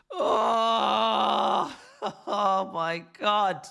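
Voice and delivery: shouted oh, then slow laughing oh my god; laughing exclamation. Real, not acted. Fan reading the lineup, laughs in disbelief.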